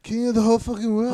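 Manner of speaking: mock victory boast. Friend playing the winning fighter, hammed-up loud boast. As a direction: play the champion yelling to the arena.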